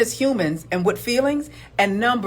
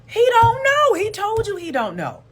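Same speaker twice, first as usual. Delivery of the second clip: high, loud cry of frustration; he-don't-know yelled high; exasperated outburst; 12 semitones higher, 4 dB louder than usual. Real, not acted. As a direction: shout it in disbelief.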